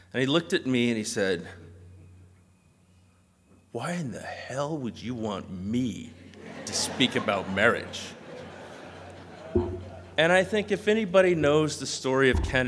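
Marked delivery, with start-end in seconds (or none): 3.7-8.0 slow, deliberate imitated disbelief; incredulous question. Ken asked to speak at a wedding, slow incredulity. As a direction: draw out the baffled question for effect. Divorced friend asked about marriage, retold.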